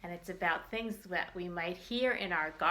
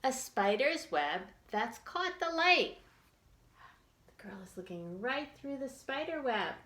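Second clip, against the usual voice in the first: put-on reading to children; 3 semitones higher than usual. Teacher reads picture book to children.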